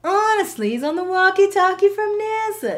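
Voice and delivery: loud, high mock English-sounding accent; almost-English accent. Shown as a demonstration. Showing cohost how the server talked, put-on English-like accent. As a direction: imitate a whiny complaint, almost English. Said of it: "You sound like you're from England almost".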